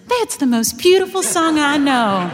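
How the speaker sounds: high, loud, naive delivery; the young Magnolia before she aged, high and loud; young bright version. Retold or imitated. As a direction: sing it out like the carefree girl.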